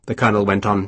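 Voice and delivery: plain reading voice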